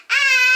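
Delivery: high, full loud yell; loud scream. Acted. Jeremiah screaming over spilled paint, full loud yell. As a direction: scream as loud as you can. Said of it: "yelled as loud as he could".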